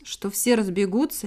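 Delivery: usual speaking voice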